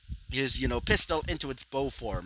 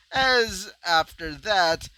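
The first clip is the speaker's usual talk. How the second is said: ordinary delivery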